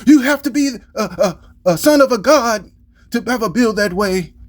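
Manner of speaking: high, awed anime character voice; anime inner monologue. Retold or imitated. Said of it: "inner thought of an anime".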